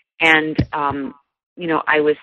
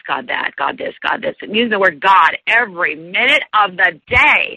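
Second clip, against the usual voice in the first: usual speaking voice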